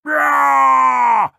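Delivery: loud, high, long cry of fright; cartoon pig's frightened scream; terrified squeal. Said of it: "gave a terrified squeal".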